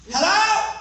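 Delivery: mock shouting as if the listener can't hear; loud hello. Imitating someone who shouts hello at a deaf man. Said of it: "you're bad is talk loud, loudly".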